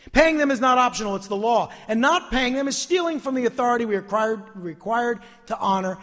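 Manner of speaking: firm sermon insistence; forceful preaching. Real, not acted. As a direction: lay down the rule like a preacher.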